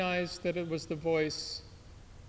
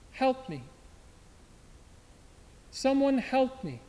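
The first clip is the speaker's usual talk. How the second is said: cry for help; 7 semitones higher than usual. Acted. Wounded soldier cries out.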